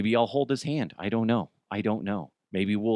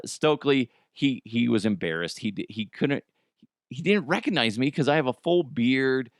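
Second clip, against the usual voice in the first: ordinary talk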